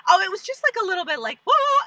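high, hammed-up tactful reaction; mock polite listener. Friend politely covering for bad singing, high and hammed-up. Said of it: "you're like".

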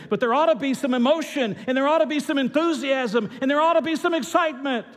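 high, ringing preacher's cry; impassioned exhortation. Real, not acted. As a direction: drive each phrase home with rising fervor. Preacher urging congregation to show emotion.

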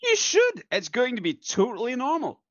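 high-pitched, too-insistent reassurance; unconvincing reassurance. Acted. Answering a doubter about the door, unconvincing reassurance. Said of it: "makes it sound less believable".

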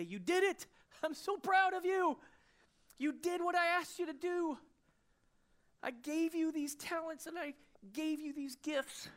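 high, loud, overjoyed praise; Jesus proud of his follower, high joyful praise; Jesus praising. Acted. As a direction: beam with pride as Jesus.

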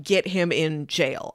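normal talking voice

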